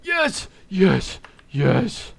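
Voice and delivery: loud high victory shouts; triumphant cheer. Real, not acted. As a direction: cheer the win. Triumph in game.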